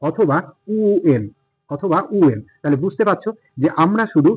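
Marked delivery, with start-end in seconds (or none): 0.7-1.2 "ইউএন" said as u-en, no h; British-style u variant. Shown as a demonstration. Teacher offering the other British option, "ইউএন" as oo-en. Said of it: "ও sound করব আর উ sound করব". 2.2-2.4 "ইউএন" said as u-en, no h; British-style u variant. Shown as a demonstration. Teacher offering the other British option, "ইউএন" as oo-en. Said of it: "ও sound করব আর উ sound করব".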